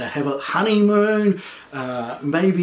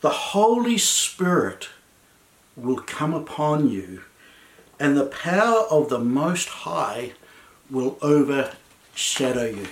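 slow and measured, sinking low; solemn angelic answer; 3 semitones lower than usual. Retold or imitated. Angel answers Mary's question, solemn and slow. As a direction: deliver it gently, with long reverent pauses.

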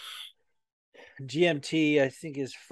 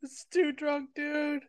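mock whine; 12 semitones higher, 5 dB quieter, much slower than usual. Drunk whining, crying sound heard.

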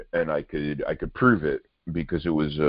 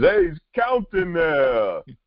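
put-on Italian-American accent; 13 semitones higher, 3 dB louder than usual. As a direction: butt in like an indignant Bronx Italian. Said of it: "Can't do an Italian accent".

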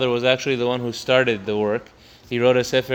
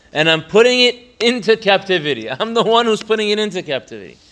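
fervent emphasis; 8 semitones higher, 5 dB louder, faster than usual. Real, not acted. Teacher builds to emphatic climax.

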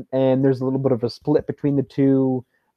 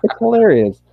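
high, louder, through laughter; laughing; 10 semitones higher, 5 dB louder than usual. Real, not acted. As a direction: say it while cracking up. Both laughing at quoted line.

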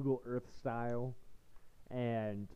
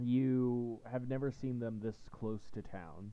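slower, each word spelled out; slow clear repeat; slower than usual. Real, not acted. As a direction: repeat it slowly for a bad line. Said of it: "it cut out a little in the middle".

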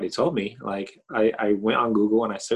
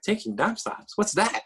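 confused surprise; 11 semitones higher than usual. Retold or imitated. Retold baffled reply.

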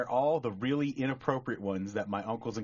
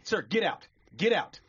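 raised, higher dismissal; stern shopkeeper; 7 semitones higher, 4 dB louder than usual. Acted. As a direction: order a customer out, fed up. Shopkeeper throws customer out.

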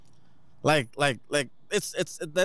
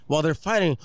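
normal talking voice

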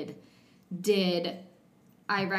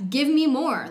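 louder, higher, hungry for more; fired-up triumph; 8 semitones higher, 9 dB louder than usual. Acted. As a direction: call it out like a victor. Acting out triumph over fears.

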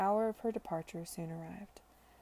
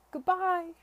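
loud, high farewell call; called out goodbye; 11 semitones higher, 10 dB louder than usual. Acted. Charlotte calling goodbye from the moving carriage, loud. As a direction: call it after the departing carriage. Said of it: "she cried as it moved off".

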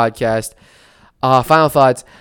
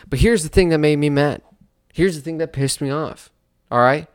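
normal talking voice

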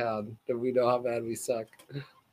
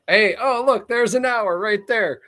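upbeat sign-off; 7 semitones higher, 5 dB louder than usual. Real, not acted. Cheerful show sign-off.

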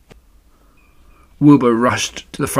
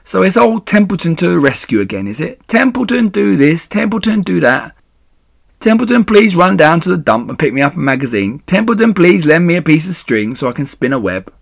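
higher, loud sarcastic griping; mock every favour ever asked; disgusted; 7 semitones higher, 5 dB louder than usual. A narrator's reading. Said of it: "he said in disgust".

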